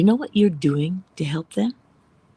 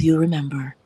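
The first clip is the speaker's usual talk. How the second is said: usual speaking voice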